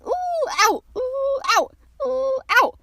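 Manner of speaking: high hooted "ooh", dropping to loud "ow"; bouncing ooh-ow. A narrator's reading. Piglet bounced all the way home, high ooh, thudding ow. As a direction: swoop up and bump down each time. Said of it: "he was saying".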